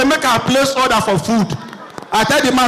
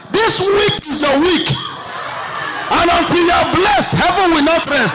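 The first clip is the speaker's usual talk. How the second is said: higher-pitched, declaiming pastor voice; fervent pastor; 4 semitones higher than usual. Acted. Pastor promising blessing to church, high fervent proclamation. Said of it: "wey the pastor dey sound like this".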